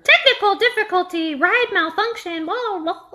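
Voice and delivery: flat automated robot announcer. Retold or imitated. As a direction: read it like a robot on a loudspeaker. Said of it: "automated robot voice comes over the loudspeaker".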